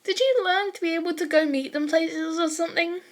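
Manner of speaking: put-on high, bouncy teen girl asking eagerly; high excited girl teasing a classmate; perky girl voice. A narrator's reading. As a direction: lift the voice way up, curious and playful. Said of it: "Ashido pipes up".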